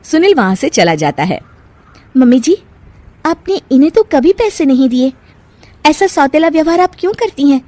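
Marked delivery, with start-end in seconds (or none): 2.2-7.6 put-on higher, pointed young bahu; challenging daughter-in-law. Bahu confronting saas about favouritism, higher and reproachful. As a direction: question the elder reproachfully.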